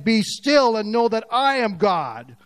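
slow, stern rebuke to the nations; God rebuking nations. Acted. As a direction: command them to stop, slowly. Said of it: "he's looking at them and saying".

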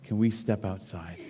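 soft, low, near whisper. A narrator's reading. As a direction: ask it under your breath. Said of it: "Jack whispered".

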